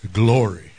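low, drawn-out 'Glory'; solemn cry of glory. Acted. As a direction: intone it deep and loud, reverently. Quoting the temple's cry.

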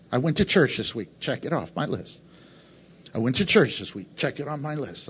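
low, soft mock of a smug churchgoer ticking a box. Pastor mocking a checklist churchgoer, soft and low.

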